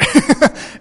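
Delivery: sudden loud, breathy laugh; short laugh. Real, not acted. Father admitting his heart broke, short loud laugh. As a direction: burst out in a brief rueful laugh.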